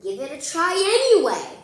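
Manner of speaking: eager high call. Acted. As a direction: cheer them on to try. Excited invitation to try.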